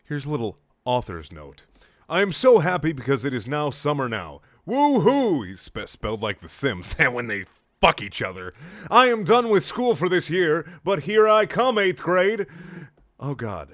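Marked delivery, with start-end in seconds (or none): 2.1-5.4 put-on bright, louder voice of a gushing kid writer; mock kid author voice. Mock reading of kid author's note. 8.9-12.5 put-on higher, louder gushing kid voice; mock kid author voice. Mock reading of kid author's note.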